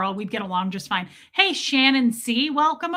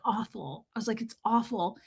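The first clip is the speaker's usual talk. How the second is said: glum reply; 7 dB quieter than usual. Retold or imitated. Answering Tommy's text.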